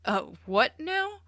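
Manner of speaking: high, loud and slow alarm. Acted. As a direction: recoil in alarm. Classmate alarmed by snakes.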